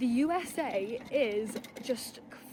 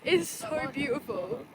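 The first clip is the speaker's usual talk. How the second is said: normal talking voice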